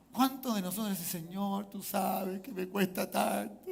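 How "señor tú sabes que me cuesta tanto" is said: soft, slow, drawn-out mock lament; imitated complaint. Believer telling the Lord it's hard, soft mock complaining. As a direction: drag it out as a quiet plea.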